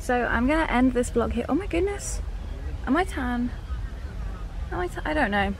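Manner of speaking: ordinary talk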